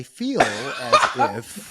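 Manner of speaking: put-on slow, drawn-out Californian accent. Told to talk Californian, slow exaggerated Californian accent. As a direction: stretch it out in a mock Californian voice. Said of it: "Talk like a Californian".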